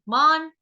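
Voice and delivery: मान with long aa, ending on n; half n demo. Shown as a demonstration. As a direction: say मान slowly, no vowel after n. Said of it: "म आ आधा न".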